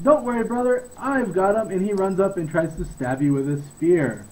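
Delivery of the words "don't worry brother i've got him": higher-pitched guard calling out; half-raised yell to a comrade; mild acted yell. Guard calls to his brother as he charges, mild yell. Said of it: "he yells out".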